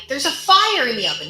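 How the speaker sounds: mock scream. Girlfriend warns of oven fire, imitated screaming. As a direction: call out the alarm, short of a real scream. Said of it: "like, screaming".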